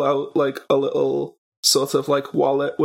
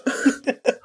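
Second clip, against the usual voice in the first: muffled giggle; 8 semitones higher than usual. Real, not acted. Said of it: "you sound like you're actually meeting a real ghost".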